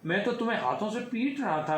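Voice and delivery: put-on high, innocent little boy voice; innocent child. A narrator's reading. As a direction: explain it plainly like a small child. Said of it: "Ram ने मासूमियत से कहा".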